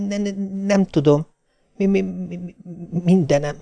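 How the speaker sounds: high, soft, teeth-chattering stutter; shivering stammer. A narrator's reading. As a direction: stutter feebly as if shaking with cold. Shivering wife stammers her complaints. (magas, vacogó, dadogó hang)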